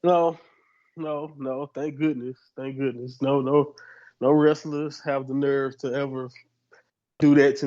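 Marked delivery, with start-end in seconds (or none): none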